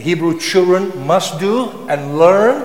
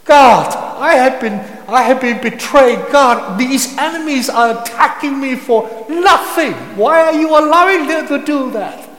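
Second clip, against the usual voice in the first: loud, high, building to a shout at God; a psalmist shouting his complaint to God; angry psalmist crying out; 7 semitones higher, 4 dB louder than usual. Acted. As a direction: rail at God, betrayed and furious. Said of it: "you find men telling God".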